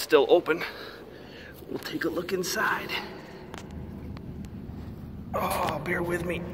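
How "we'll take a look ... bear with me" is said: inside a silo. Real, not acted.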